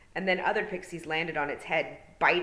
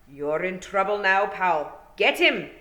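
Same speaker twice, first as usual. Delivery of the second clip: pixie war cry; 3 semitones higher, 8 dB louder, slower than usual. A narrator's reading. Rob Anybody to the boy, loud gleeful war cry. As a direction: rouse the pixies to charge. Pixie chief orders attack.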